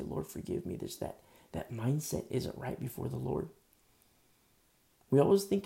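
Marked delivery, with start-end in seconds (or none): 0.0-0.8 hushed, unvoiced plea for forgiveness; whispered prayer. Praying on his knees after an outburst, whispered. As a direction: breathe it like a kneeling confession. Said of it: "you come to your knees and you say".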